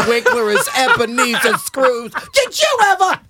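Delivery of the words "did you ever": ordinary talk, nothing marked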